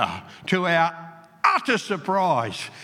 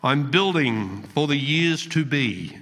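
poem recital; 5 semitones lower than usual. Acted. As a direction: recite it gently, letting each line fall. Reads a poem aloud.

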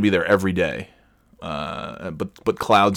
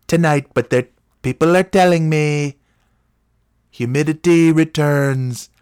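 higher, louder put-on forecaster voice; Wankham impression; 7 semitones higher, 4 dB louder than usual. Imitating the weatherman giving the forecast, loud and higher. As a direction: boom the forecast like a pompous weatherman. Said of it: "that's a pretty good Mark, Mark Wankham".

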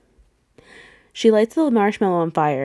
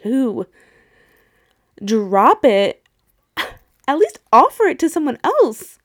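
mock livid yelling; 4 semitones higher, 4 dB louder than usual. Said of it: "I was livid".

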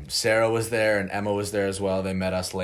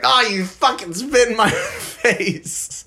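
high squeaky mock voice, laughing midway; imitating his friend Connor, high mock outrage; 12 semitones higher, 5 dB louder, slower than usual. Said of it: "Connor was like".

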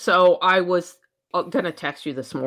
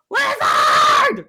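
shrill shriek, far higher and louder; startled gardener shrieking at a giant lizard; startled scream; 6 dB louder than usual. Retold or imitated. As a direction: scream it like the lizard just jumped you. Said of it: "I'm all".

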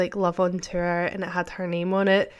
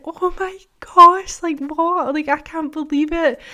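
much higher and louder, slow, thrilled squeal; excited squeal; 9 semitones higher, 6 dB louder than usual. Retold or imitated. Girl squealing to her friend over concert tickets, excited. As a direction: squeal it like a kid who just got tickets. Girl realises she's seeing [name].

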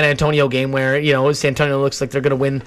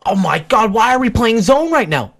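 mock yell; 8 semitones higher, 3 dB louder than usual. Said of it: "And he just yells".

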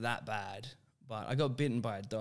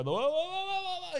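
much higher, backing-off whoas; alarmed whoas. Retold or imitated. Retreating from the dog, high alarmed whoas. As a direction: squeal them out as you retreat.

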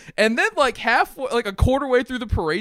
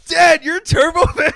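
put-on high, loud amazed child; mock wide-eyed disbelief, high-pitched; astonished little kid; 9 semitones higher, 7 dB louder than usual. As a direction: shout it as a stunned kid. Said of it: "And Jamie's like".

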